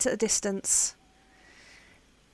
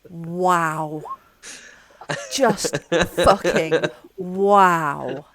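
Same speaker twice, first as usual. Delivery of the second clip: stunned; 4 semitones lower, 6 dB louder, much slower than usual. Real, not acted. Stunned reaction to vile result.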